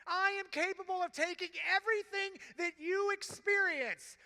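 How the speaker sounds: Jesus speaking from the cross, building to full preaching force; fervent preaching. Acted. Jesus promises the hurting boy he will stay, rising fervent preaching.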